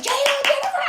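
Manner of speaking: high shouted crowd call; arena crowd screaming her name; imitated screaming. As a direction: yell it like a whole crowd. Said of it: "like, screaming".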